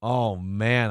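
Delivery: ordinary delivery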